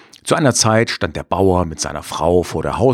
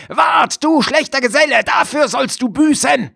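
wolf shouting. Acted. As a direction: yell a threat after him. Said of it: "schrie aber dem Hund zu".

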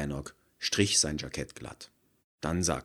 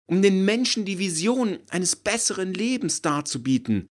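rapturous pitch; 9 dB louder than usual. Acted. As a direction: preach the vision with zeal. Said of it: "rief von Gerteljung".

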